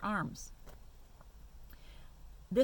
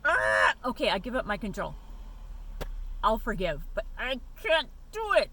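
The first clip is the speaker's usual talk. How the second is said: pleading surrender; 3 semitones higher, 7 dB louder than usual. Retold or imitated. Giving up control to God.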